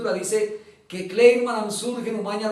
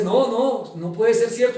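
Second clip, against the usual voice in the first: mild retold disbelief, louder and higher; 3 semitones higher, 6 dB louder, slower than usual. Jailed lawyer reacting to release news, loudly disbelieving. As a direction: protest as if it cannot be true.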